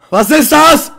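sudden high shriek of fright; jump-scared, shriek the question; startled scream. Real, not acted. Gamer startled by a figure on the horror server, shrieking.

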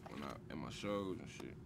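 usual speaking voice

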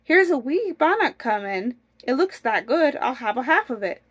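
lively, mildly excited storybook husband; eager call, lightly acted; crying out. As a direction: announce a lucky find to your wife. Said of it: "cried the goodman".

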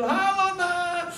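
put-on high, slow, drawn-out hello to a child; elated parent's sing-out greeting; happy greeting. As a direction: greet your child with delight, stretching the words. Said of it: "saya seneng gitu".

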